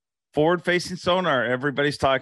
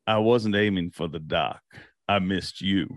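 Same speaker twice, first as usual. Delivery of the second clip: low-pitched quote of a fellow angler; low, deadpan-style impersonation; imitated angler; 7 semitones lower than usual. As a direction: drop the voice low to play him. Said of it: "He's like".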